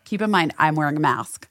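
normal talking voice

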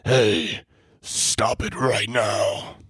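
slow, strained whisper-yell; bad Batman, yelling in a whisper. Shown as a demonstration. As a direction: growl it slowly through a blocked throat. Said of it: "he's trying to yell from a whisper".

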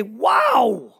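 put-on big loud impressed wow for the kid. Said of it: "and say".

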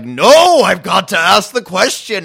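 high, loud, hammed-up desperate protest; mock freak-out. Host imitating a frantic character refusing medication, mock-desperate outburst. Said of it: "freaking out".

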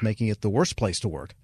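ordinary talk

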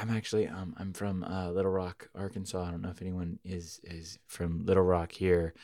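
soft, timid singer's aside; meek voice. Retold or imitated. Band singer timidly telling crowd her hometown, meek. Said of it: "she says it in this very meek voice".

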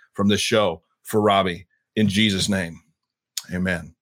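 earnest prayer. Acted. Host praying for guest heading to the Middle East, reverent prayer. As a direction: pray over him, calm and sincere.

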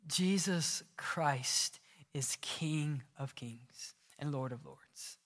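slow, reverent whisper. Real, not acted. Pastor proclaiming Christ's kingship to the church, reverent whisper. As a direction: breathe it out slowly, in awe.

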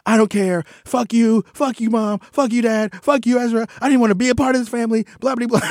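high-pitched imitation of a ranting teenager; hammed-up bratty teen outburst, pitched high; mock teen tantrum. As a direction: play the daughter blowing up at the whole family. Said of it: "She'll be like".

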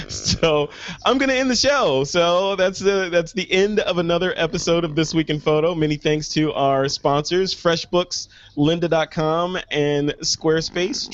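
upbeat show sign-off; host wrapping up the episode; announcer voice. Acted.

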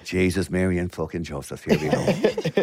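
playful put-on Irish accent, low at first; Irish accent with a laugh creeping in. Shown as a demonstration. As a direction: ham up an Irish exclamation. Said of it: "Could you actually do that".

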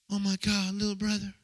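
a hint of an older singer's fond greeting. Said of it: "That's how he talks".

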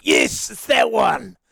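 loud, drawn-out cry of triumph; excited shout. Retold or imitated. Host reliving a quiz guess, excited shout. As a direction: call it out like you've just nailed the answer. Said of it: "and I'm like".

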